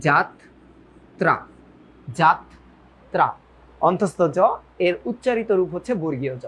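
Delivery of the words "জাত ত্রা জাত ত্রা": যাত্রা broken into জাত and ত্রা with pauses between; split into syllables. Shown as a demonstration. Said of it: "তখন আমার লিখতে হবে জাত". (যাত্রা ভেঙে: জাত... ত্রা)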